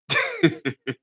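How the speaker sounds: squeaky high laugh; real mocking laugh up high. Real, not acted.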